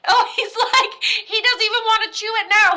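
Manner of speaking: can't stop laughing at the joke. Real, not acted. Laughing at the dead dad's spaghetti wish.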